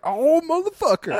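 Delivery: very high-pitched comic outcry; mock dismay. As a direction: exclaim it high, hamming up the dismay. Mock dismay at waitress's imagined reaction.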